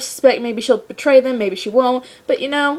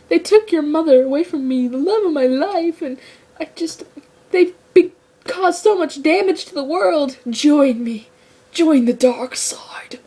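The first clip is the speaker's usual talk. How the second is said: pleading villain father; 5 semitones higher than usual. Retold or imitated. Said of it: "he's like".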